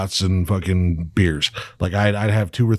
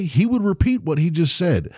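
ordinary talk

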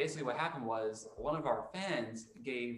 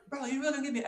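imitated angry protest; 10 semitones higher, much faster than usual. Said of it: "so he gets pissed off".

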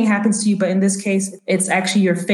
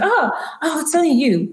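high startled "oh", then easing; jumpy, high-voiced reaction on being surprised; startled woman; 8 semitones higher than usual. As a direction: act mildly freaked out, pitched up. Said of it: "so she kinda freaks".

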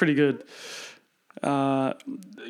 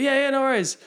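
breezy mock agreement; 11 semitones higher, faster than usual. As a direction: imitate easygoing staff waving it off, pitched right up. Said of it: "we'd be like".

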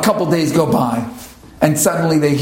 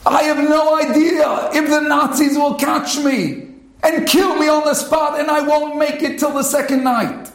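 much higher, slow, pleading; impassioned pleading read; 10 semitones higher than usual. Acted. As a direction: plead as the note's writer. Climax of the read note.

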